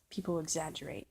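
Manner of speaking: usual speaking voice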